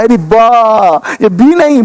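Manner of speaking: "बा" said with an open a, set against "बी"; "बा" not "बी". Shown as a demonstration. Teacher correcting the letter name to its sound. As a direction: stress the open a in "बा". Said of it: "यानी ये जो बी है".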